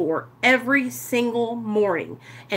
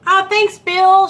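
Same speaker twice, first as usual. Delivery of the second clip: normal talking voice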